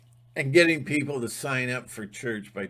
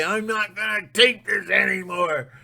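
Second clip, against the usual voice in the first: Howard Beale rant; 8 semitones higher, 5 dB louder than usual. Retold or imitated. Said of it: "like Howard Beale says".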